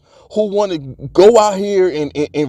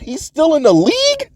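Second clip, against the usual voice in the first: surprised; 9 semitones higher than usual. Retold or imitated. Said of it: "I said".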